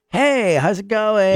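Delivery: put-on friendly waiter voice; tip-seeking server. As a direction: draw it out like a server after a tip. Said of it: "tip culture's bigger. So it's very much like".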